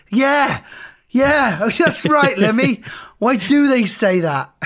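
high, loud cheering agreement; excited shout. Retold or imitated. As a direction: shout along at the TV.

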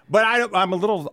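ordinary delivery

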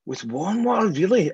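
high, amazed repeat of her words; can't believe what you just heard; incredulous pitch jump on "warm water"; surprised echo. Real, not acted.

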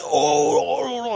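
high, flustered "oh, oh"; mock spluttering neighbour. Neighbour caught out by the narrator, spluttering high. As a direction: ham up a flustered old bloke.

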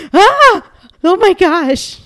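high, loud gasp of delight; retold excitement. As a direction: re-enact being thrilled, pitch leaping up. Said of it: "It was so exciting".

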